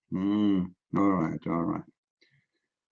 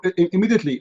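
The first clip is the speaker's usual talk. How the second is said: ordinary talk, nothing marked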